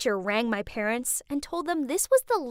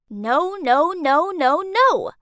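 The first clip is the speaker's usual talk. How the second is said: rising, louder string of protests; exasperated no's; 4 semitones higher, 6 dB louder, slower than usual. Acted. Teen ranting about mom's strict rules, rising exasperated outburst. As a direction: pile up the no's till they burst.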